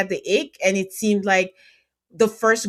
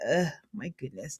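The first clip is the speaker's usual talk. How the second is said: exasperated groan; 6 semitones lower, 10 dB quieter than usual. Retold or imitated. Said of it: "And I'm like".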